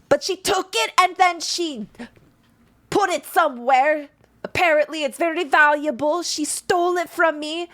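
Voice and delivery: high, loud furious complaint; angry accusation. Acted. Dwagar accuses thief.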